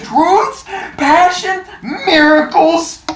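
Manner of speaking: put-on loud, excited old grandpa listing; grumpy old man. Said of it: "old man voice".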